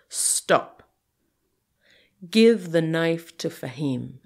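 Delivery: a sharp royal stop, loud and sudden; commanding shout. A narrator's reading. King stops the cook at the table, loud command. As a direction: bark the order across the table.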